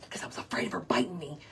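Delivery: quick hushed aside; fast whisper. Real, not acted. Confessing fear of the hen to viewers, fast whisper. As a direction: rush it out in a whisper. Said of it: "unvoiced whisper".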